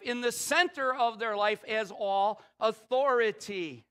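high, loud, slow preacher's cry; preaching at full force. Acted. Pastor to congregation, full-force preaching. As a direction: proclaim it to the whole church.

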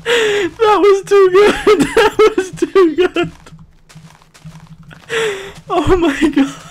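slow, high squealed laughing words; crying with laughter. Real, not acted. Friends losing it over the impression, crying with laughter. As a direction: wheeze it out high between laughs. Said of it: "I'm crying with laughter".

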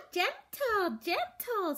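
put-on very high, singsong little-girl echo; toddler voice. A narrator's reading. Toddler echoing her brother's word. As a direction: repeat it like a toddler copying. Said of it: "said Boots".

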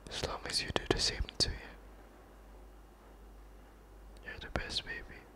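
intimate comforting whisper, close to the mic; hushed boyfriend whisper; soft whisper. Acted. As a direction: whisper reassurance to your girlfriend.

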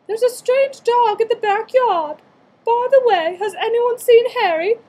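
high, loud put-on voice of a family member. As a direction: call out the line in a loud, high character voice. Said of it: "One of the family looked out and said".

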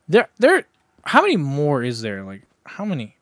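ordinary talk, nothing marked

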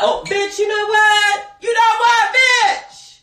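high loud squealed teasing; excited squeal. Real, not acted. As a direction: squeal it out playfully. Excited outburst.

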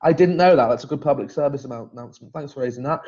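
ordinary talk

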